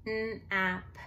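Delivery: "n-ap" tapped out sound by sound. Shown as a demonstration. Teacher showing students how to spell, sounding out. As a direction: separate the sounds in "n-ap" with small gaps. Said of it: "you wanna spell by syllable".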